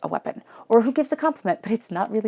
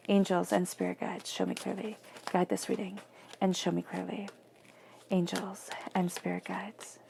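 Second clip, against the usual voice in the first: soft invocation; 9 dB quieter than usual. Acted. Reader quietly invoking angels before the tarot spread. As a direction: call softly on the spirit guides.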